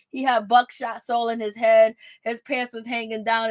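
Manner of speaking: normal talking voice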